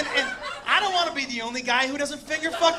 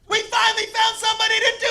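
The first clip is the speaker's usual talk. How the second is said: high, loud, quick holler; triumphant shout; 10 semitones higher, 5 dB louder, faster than usual. Retold or imitated. Dude announcing after finger pops out, triumphant yell. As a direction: announce it gleefully to the whole crowd.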